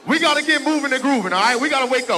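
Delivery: loud, high, shouted workout calls; shouting counts to a church crowd as the music plays; fitness hype man. Real, not acted. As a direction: pump up the crowd like an aerobics instructor. Hypes crowd into dance workout.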